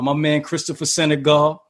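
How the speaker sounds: normal talking voice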